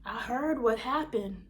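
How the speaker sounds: lightly hammed-up worried friend; concerned girlfriend. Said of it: "She's like".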